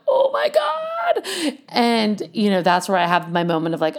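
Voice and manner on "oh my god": a high, slow, cracking cry of fear; crying out in panic. Retold or imitated. Retelling the ambulance moment, slow high panicked cry. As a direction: wail it like the pain just hit. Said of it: "that's when I'm like".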